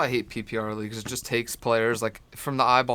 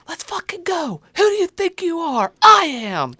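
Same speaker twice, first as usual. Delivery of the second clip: shouted quote; 8 dB louder than usual. Retold or imitated. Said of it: "and I'm like".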